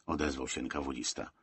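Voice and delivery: ordinary delivery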